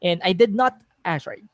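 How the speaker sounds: ordinary delivery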